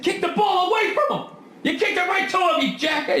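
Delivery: high mock coach tirade; furious head coach. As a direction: bark it like a coach at halftime. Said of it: "yelling at me like a head coach mad at halftime".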